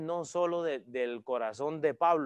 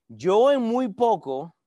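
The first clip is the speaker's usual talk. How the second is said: "Yo en muy poco" drawn out slowly, louder, with weight on "Yo"; slow pause-marked reading; 5 semitones higher, 7 dB louder, much slower than usual. Shown as a demonstration. Preacher rereading the verse for its pauses, slow and weighty. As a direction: linger on every word of "Yo en muy poco". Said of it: "vamos otra vez por las pausas".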